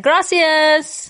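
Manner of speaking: put-on Gracias said high with a Spanish accent; mock Spanish reply. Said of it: "I'm like".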